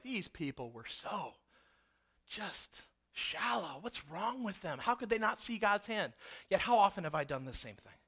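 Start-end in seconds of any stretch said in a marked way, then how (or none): 0.0-6.1 much softer, slow sneering aside; a quiet mock sneer; scornful judging. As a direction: look down on them quietly.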